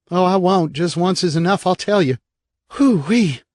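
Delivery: put-on high, bright-eyed kid whooping then chatting; little brother bragging about his fall; cheerful little boy. Eric voiced as a boy.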